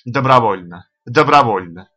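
plain reading voice

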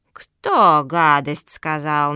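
low, loud, slow demand; frowning granny tortoise. Acted. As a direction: demand it slowly as a cross old tortoise. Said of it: "нахмурилась бабка-черепаха".

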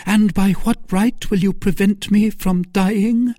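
high, dramatic challenge; exclaiming in defiance. A narrator's reading. Milady seizes the knife and challenges them, high, exclaiming.

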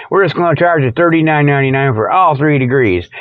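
ordinary talk

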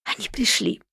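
hushed restraint. Acted. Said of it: "едва сдерживаясь".

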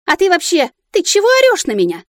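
высокий возмущённый крик; сорвись на громкое негодование; эмоционально. A narrator's reading. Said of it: "мою эмоциональную речь". (возмущённо, на крике)

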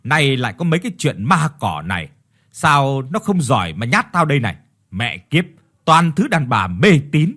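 loud scornful scolding; angry husband berating superstitious women, loud. Acted. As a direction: dismiss their ghost talk loudly. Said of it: "mà quát".

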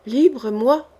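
incredulous, stunned question; quivering disbelief. A narrator's reading. Prisoner asking the king about his freedom, trembling disbelief. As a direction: pitch leaps on the question. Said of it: "frémit-il".

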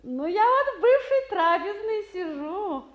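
loud, high and laughing into the receiver; bubbly phone voice with laughter. Acted. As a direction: chatter away gaily, laughing between words. Cheerful phone chat, laughing.

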